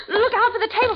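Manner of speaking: high sobbing panic; panicked sobbing. Acted. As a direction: cry out in terror, then choke. Blind woman panics in light.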